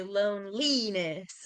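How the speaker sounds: loneliness as lone-LEE-ness, middle stressed; misplaced middle stress in loneliness; wrong stress. Shown as a demonstration. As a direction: tentatively push the stress onto LEE in loneliness. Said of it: "you wouldn't say".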